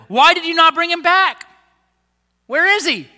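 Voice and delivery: high, loud indignant demand; loud accusing priests; angry demand. Acted. Chief priests demanding of officers.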